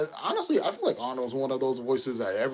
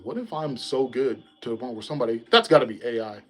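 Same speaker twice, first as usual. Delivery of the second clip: normal talking voice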